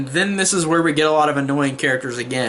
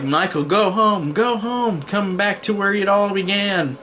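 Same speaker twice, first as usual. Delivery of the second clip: drawn-out, higher-pitched mock yell; retold scream; 6 semitones higher than usual. Loomis calls to Michael in the woods, mock scream. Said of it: "he screams to Michael".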